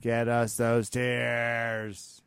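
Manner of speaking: very slow, savoring each word; drawn out. Acted. Cohost gleefully demands tears from fighters, drawn out slowly. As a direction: stretch it out gleefully. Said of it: "repeated slowly".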